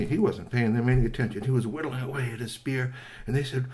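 ordinary delivery, nothing marked

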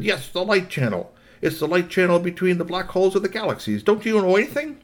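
high-pitched, exasperated robot boss; exasperated Billy Rob. Acted. Robot boss answering about the light channel, exasperated. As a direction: explain it as if to an idiot.

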